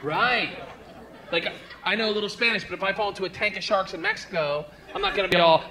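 ordinary talk

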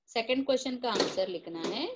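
ordinary delivery

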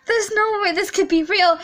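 high, distraught outburst; horrified disbelief. Acted. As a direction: refuse to believe the nightmare. Reads hero's shock at friend's death.

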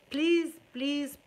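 mock desperate plea to the viewers; loud begging. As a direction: plead with them, stretching each "بليز".

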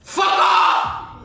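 high, loud yell; shouting. Acted. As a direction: scream it back at her. Shouted refusal.

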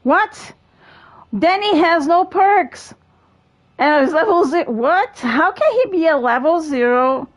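high, loud disbelief; can't believe what you see; surprised. Real, not acted. Shocked at a level-zero player.

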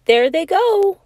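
high squeaky little wood rat. Acted. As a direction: squeak it like a tiny rodent. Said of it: "he squeaked".

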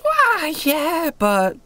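put-on very high girlish reply; perky girl agent. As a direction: answer a threat in a high, airy girl's pitch. Girl agent answers a threat.

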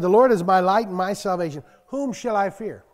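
loud, ringing scripture proclamation; preaching scripture. Acted. Preacher booming a psalm to the church, loud and declaiming. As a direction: proclaim it to the congregation.

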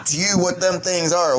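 hammed-up Charlie Sheen voice; Charlie Sheen impression. Mocking Charlie Sheen, listing what he likes. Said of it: "He'd be like".

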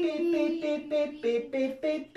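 ordinary talk, nothing marked

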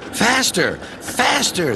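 high-pitched mild yell; yelled urging. A narrator's reading. Cars goad the engine downhill, high mild yell. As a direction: urge it on, pitch climbing on the repeat. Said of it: "they yelled".

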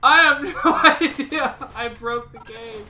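loud, high laughing disbelief. Real, not acted. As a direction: laugh through the words. Game glitch.

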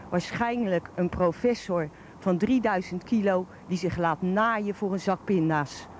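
usual speaking voice

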